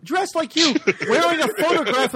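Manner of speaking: high, loud mock reveal; mock dramatic reveal. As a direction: blurt it out like a big reveal. Mock-dramatic quote of the line.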